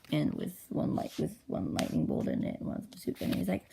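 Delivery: ordinary delivery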